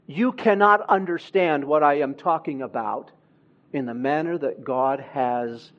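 usual speaking voice

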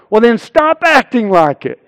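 loud, high comeback, acting the exasperated wife; retold sharp retort. Wife's retort in retold quarrel.

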